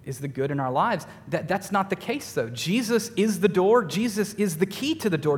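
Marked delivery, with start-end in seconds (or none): 2.5-5.4 higher, emphatic sermon close; preaching. Acted. Pastor declaring Jesus is everything. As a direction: build each line about Jesus.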